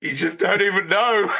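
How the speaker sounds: high, mock-tearful mascot voice; hammed-up pitiful protest; Zool. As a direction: whine it with a fake cry, rising high. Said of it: "Zool over there sniffing a Chupa Chup like".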